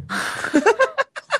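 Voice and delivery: a very high rising laugh. Real, not acted. Laughing at the irony of the street name.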